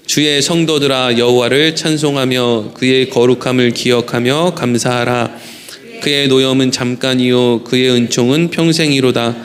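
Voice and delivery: slow, measured psalm reading; scripture reading. Acted. Pastor reads psalm verses to the congregation, slow and solemn.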